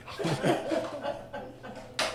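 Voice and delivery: high breathless giggle; quiet guilty chuckle; soft breathy laugh. Real, not acted. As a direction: let a sneaky laugh slip out. Laughing after confession.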